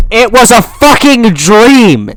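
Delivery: loud, slow, furious yell; outraged shout. Real, not acted. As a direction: roar it in disbelief. Outraged at the dream ending.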